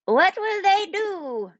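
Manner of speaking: put-on high, slow, quavering old man's call; old man crying out to the wizard. A narrator's reading. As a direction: call out like a worried old villager. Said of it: "an old man called out".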